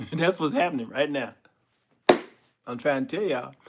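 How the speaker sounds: ordinary talk, nothing marked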